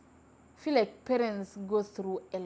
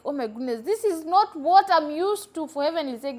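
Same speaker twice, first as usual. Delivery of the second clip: shocked disbelief; 6 semitones higher, 6 dB louder than usual. Retold or imitated. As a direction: react as if scandalised by rowdy kids. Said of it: "and you're like".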